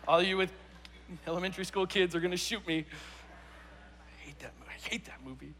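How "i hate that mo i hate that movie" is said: unvoiced whisper, very soft; whispered aside. Real, not acted. Preacher aside to congregation about kids' movie, whispered. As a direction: whisper it like a private confession.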